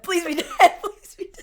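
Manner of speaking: high, laughing mock plea. Monk begging after her eye falls out, laughing. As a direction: squeal it through laughter.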